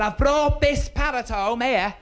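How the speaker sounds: slow, high, stretched-out calling; shouting for deaf gran. Acted. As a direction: spell it out for someone hard of hearing. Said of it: "my gran was a bit Mutt and Jeff".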